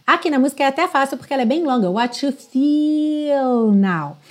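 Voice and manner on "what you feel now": slow, "feel" stretched long. Shown as a demonstration. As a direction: savour the EE in "feel". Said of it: "esse som mais longo". ("feel" bem alongado, devagar)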